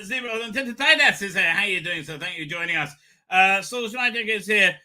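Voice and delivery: usual speaking voice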